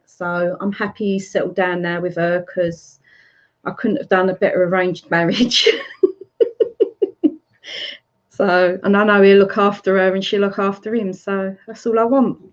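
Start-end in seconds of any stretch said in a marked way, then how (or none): 5.7-7.3 high, rippling giggle. Real, not acted. Mother amused at her son's arranged marriage, giggling. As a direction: laugh in high, quick bursts.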